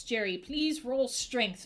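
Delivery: ordinary talk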